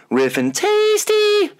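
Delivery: very high, slow squeaky clown voice; silly clown. Acted. Silly intro voice.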